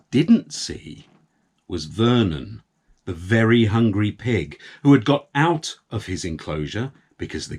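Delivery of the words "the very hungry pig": plain reading voice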